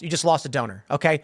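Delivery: ordinary delivery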